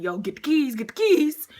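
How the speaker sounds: loud, rising urgent repeat; freaked-out urging. Retold or imitated. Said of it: "He's getting freaked out".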